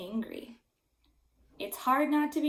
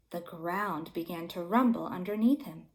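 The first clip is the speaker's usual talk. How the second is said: usual speaking voice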